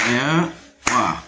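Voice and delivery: "Miroir" split in two, mi-roir, with a pause; syllable by syllable. Shown as a demonstration. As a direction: hold each half of "Miroir" apart. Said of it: "de deux syllabes". (« Miroir » en deux syllabes séparées : mi… roir)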